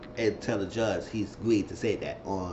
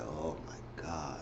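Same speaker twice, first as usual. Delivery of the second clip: exasperated sigh; 8 semitones lower, 15 dB quieter, much slower than usual. Real, not acted. Exasperated at his choice.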